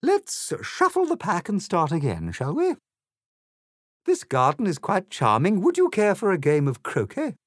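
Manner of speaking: high, loud falsely charming royal voice; sweetly haughty Queen. A narrator's reading. Queen invites Alice to croquet, grandly gracious. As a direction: turn on the regal charm.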